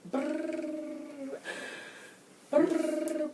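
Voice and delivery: high, slow rolled brrr sounds; mock fast talk. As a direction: trill it to mimic fast talking. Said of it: "that's how you gonna be talking that fast".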